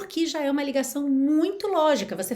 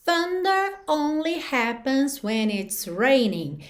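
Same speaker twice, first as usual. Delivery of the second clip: slow demo; 3 semitones higher, slower than usual. Shown as a demonstration. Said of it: "teacher reads chorus line slowly".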